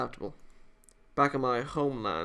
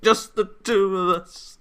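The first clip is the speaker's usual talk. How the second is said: slow, louder teasing repeat; suggestive echo; 6 semitones higher, 8 dB louder, slower than usual. Acted. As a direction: savour the words. Echoing suggestively.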